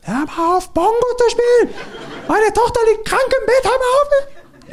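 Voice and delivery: high, shrill mock yell from a window, laughing through it; angry neighbor lady. Comedian retelling a woman yelling down at his bongo playing, mock high screech. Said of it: "eine Frau und meinte so".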